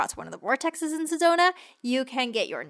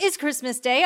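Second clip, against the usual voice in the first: usual speaking voice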